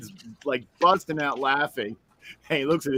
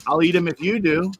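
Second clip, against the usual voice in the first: higher, louder cocky offer; eager dare; 6 semitones higher, 6 dB louder than usual. Retold or imitated. Teen telling his friend he'll match him. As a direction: toss out the challenge brightly. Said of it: "I'm like".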